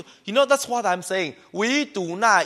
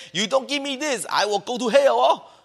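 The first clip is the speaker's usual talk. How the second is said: mock whiny threat; 6 semitones higher than usual. Preacher mimics a member threatening the pastor, mock whine. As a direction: sulk and threaten like a spoiled child. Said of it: "come to pastor and say".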